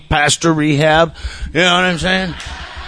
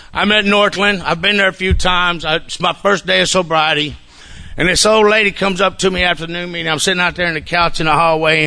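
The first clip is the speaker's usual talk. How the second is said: ordinary talk, nothing marked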